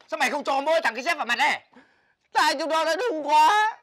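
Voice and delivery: high, loud shouting; furious demanding rant. Acted. Demanding friends help become a boy, high furious shout. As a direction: yell it like a worked-up ultimatum.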